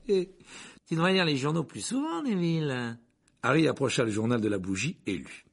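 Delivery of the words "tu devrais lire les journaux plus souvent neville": smug, higher-pitched needling; superior laugh. A narrator's reading. Conductor chiding a passenger about newspapers, superior. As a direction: tease with a superior little laugh. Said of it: "rire supérieur".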